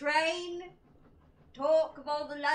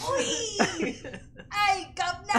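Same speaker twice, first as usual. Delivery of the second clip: loud, higher mock Cockney hail; Cockney accent; 6 semitones higher, 4 dB louder, slower than usual. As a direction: shout it like a stage Cockney.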